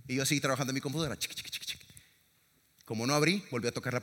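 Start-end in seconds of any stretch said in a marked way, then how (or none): 1.2-1.8 toquecitos imitados. Retold or imitated.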